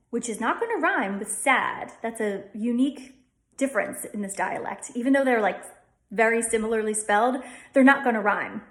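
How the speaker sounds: usual speaking voice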